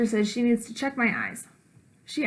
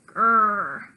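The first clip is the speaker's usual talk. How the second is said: puppy growl; 2 semitones lower, 5 dB louder than usual. Acted. Child growls at dress.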